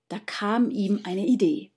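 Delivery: ordinary delivery, nothing marked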